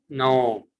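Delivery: ordinary talk, nothing marked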